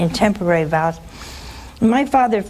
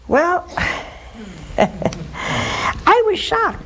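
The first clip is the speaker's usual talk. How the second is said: laughing disbelief; 7 semitones higher, much slower than usual. Real, not acted. Recalls father's unexpected offer.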